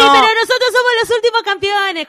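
cocky high mock voice; Mortensen's reply. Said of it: "le dijo".

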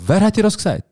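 high-pitched feigned disbelief; mock indignation. Son questioning mother about the smoking rumour, mock indignant. Acts own denial to mother.

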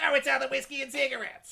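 loud, high exaggerated mimicry; teasing, over-the-top impression; mocking imitation. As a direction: throw the line back big and high. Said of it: "Do not sound like that".

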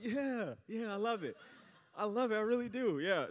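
boy pretending to his mom he loves the gift; fake delight. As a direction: sell a lie you clearly don't mean. Faking love for the gift.

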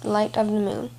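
ordinary delivery, nothing marked

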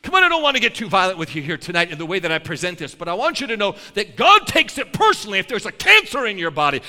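ordinary delivery

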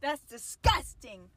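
high squealing ew of disgust, then slowed; shrieking grossed-out ew, dragging out disgusting. Real, not acted. Friend reacts to pet names.

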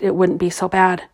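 usual speaking voice

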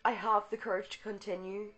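fervent spoken invocation; prayer. Acted. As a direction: proclaim the prayer with conviction. Reads invocation and healing prayer.

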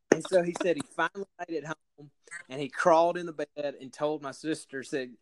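usual speaking voice

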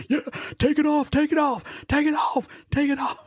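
very high, frantic mock chant; hammed-up excited yelling, far above the normal voice; imitated eager urging. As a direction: egg them on in a squealing, hurried imitation. Imitating Jay urging shirt removal.

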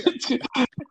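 high giggle; helpless high-pitched laughter. Real, not acted. Host amused by the circus joke, high laugh. Cracking up over sideshow joke.

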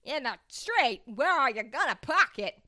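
hammed-up New York accent, badly done. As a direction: belt it like a brash New Yorker. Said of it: "I think it's getting worse and worse".

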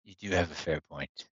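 low, soft grudging concession; gravelly sly hobgoblin. Acted. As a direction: concede it quietly through pain.

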